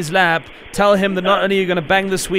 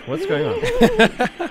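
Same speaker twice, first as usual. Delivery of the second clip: drawn-out high question; much slower than usual. Pretend. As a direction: stretch "What's going on?" slowly, pitched very high and mock-wondering. Host repeats, drawn out high.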